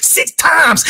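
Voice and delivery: high, drawn-out catchphrase voice; Booker T. Acted. Mocking a fighter's record in a high wrestler voice. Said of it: "in my Booker T voice".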